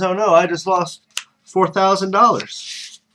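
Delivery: milk the loss like a sore loser; dismayed groan. Acted. Gambler Diamond Jack bemoaning his lost bet, in drawn out dismay.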